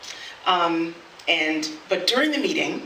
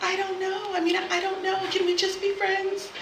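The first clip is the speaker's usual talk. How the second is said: high, soft imitation of a wavering date; hesitant letdown; 12 semitones higher, 4 dB quieter than usual. Date ending up asking for friendship, imitated hesitance. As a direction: waver gently in a small raised voice. Date's hesitant rejection acted out.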